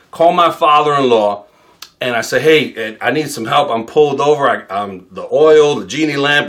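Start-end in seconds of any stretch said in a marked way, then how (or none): none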